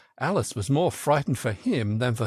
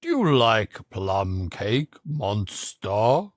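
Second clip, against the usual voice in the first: much lower, slow lion voice; the lion answering; 4 semitones lower, much slower than usual. A narrator's reading. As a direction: rumble it slowly like the lion. Lion asks Alice a question.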